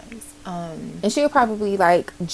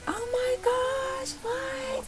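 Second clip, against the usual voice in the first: much higher, softer stunned mom; voicing the mom; 7 dB quieter, slower than usual. The mom stunned by the gift, high soft squeak. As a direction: squeak it out softly, astonished. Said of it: "she's literally like".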